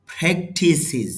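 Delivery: ফ্রেকটিসিস said slowly, stress on the first syllable; ফ্রেকটিসিস with a short final sis; model pronunciation. Shown as a demonstration. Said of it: "IPA তে খেয়াল করেন".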